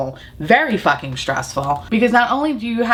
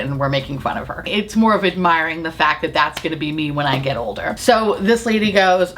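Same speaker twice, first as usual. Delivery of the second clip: ordinary delivery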